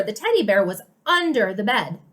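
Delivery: "under" set off and heavily stressed. Shown as a demonstration. Showing Susie where the teddy was, stress on "under". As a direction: make "under" stand out from the sentence. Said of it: "Emphasize the word under".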